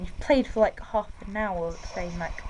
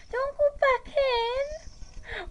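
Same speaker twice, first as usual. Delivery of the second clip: much higher, very slow stretched-out whine; drawn-out high wail; much slower than usual. Real, not acted. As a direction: drag every word out in a high upset wail. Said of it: "Why am I talking like that?".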